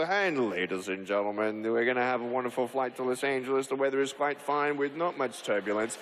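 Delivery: low, even cabin-address voice; imitating an airline pilot's patter; pilot's announcement. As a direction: drop low like a captain on the microphone. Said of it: "I get the microphone like the pilot".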